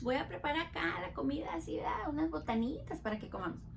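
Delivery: quick playful whisper; soft fast whispered aside. Acted. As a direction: hush it like a secret treat. Mom's plans whispered playfully.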